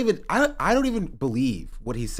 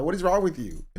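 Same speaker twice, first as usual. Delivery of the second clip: ordinary talk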